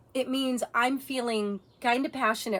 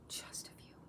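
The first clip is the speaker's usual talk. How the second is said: whisper; 18 dB quieter than usual. Real, not acted. Aside, whispered.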